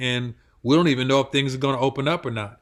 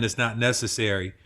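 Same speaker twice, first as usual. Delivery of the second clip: normal talking voice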